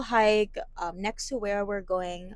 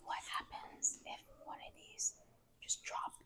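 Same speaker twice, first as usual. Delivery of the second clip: whispering; 16 dB quieter than usual. Real, not acted. Whispering in a cave.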